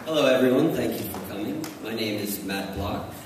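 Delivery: ordinary delivery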